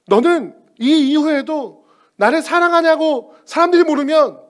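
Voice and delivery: high, loud preaching voice; impassioned preaching. Acted. Preacher pleads for Jesus's unconditional love, loud. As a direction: preach it with rising force.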